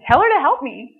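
retold indignant order; indignant demand. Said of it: "such an indignant".

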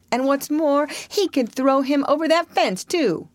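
put-on high-pitched boyish boast; bragging boast. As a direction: brag it up high to top him. New boy brags back.

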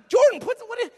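high, loud acted outburst, cut short; sudden scolding. Father catching son flipping knives, sudden scolding. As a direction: break off into a dad's yell.

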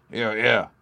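understated crowd cheer; a mild mock shout of approval. A narrator's reading. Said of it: "shouted the crowd so loudly".

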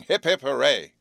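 loud, high hip hip hooray for a friend; rally the club with a big cheer; three cheers led with gusto; hearty cheer. Acted. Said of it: "this hearty cheer".

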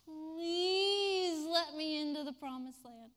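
slow pleading, crying; begging. Acted. Moses begging God, tearful. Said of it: "He begged God".

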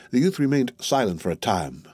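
plain reading voice

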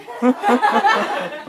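high squealing burst of laughter; real laughter breaking out. Real, not acted. Laughing after quoting the partner in the audience.

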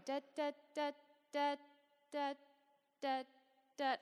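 rhythmic mock heartbeat beeps; imitated monitor beeps. Mom mimicking the baby's heart monitor, steady beeps.

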